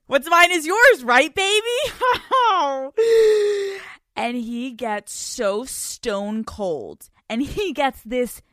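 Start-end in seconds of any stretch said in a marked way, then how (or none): 0.1-1.8 higher, louder cheeky tease; cheeky teasing. Retold or imitated. Teasing boyfriend after snooping, cheeky and higher. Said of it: "And I go". 1.8-3.9 loud high cackle; cackling laugh. Real, not acted. Laughing at her own cheek, loud cackle.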